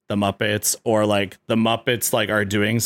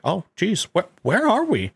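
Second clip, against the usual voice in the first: Kermit voice; 8 semitones higher than usual. Retold or imitated. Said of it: "It's like".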